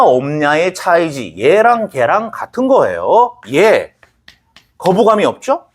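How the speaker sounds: ordinary talk, nothing marked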